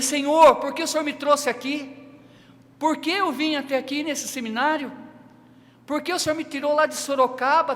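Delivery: high, loud protest to God; anguished complaint. Retold or imitated. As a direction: cry out in reproach, pleading for an answer. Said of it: "e reclamei".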